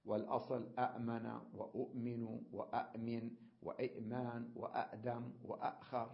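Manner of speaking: each word from أأمن to وأأخر cut by a glottal catch; original hamza forms. Shown as a demonstration. Teacher listing the original forms, each with a catch. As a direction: sound both hamzas in أأمن and every word after it. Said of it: "هكذا أصلها".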